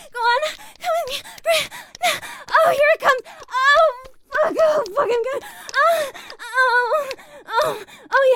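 very high, urgent moaning voice; ecstatic cries. Acted. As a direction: cry out high, overcome with excitement. Climax of an intimate scene.

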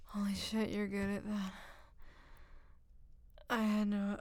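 ordinary talk, nothing marked

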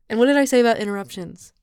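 loud, fast, playful scolding; hammed-up telling-off; mock scolding. Mock scolding partner's interruption.

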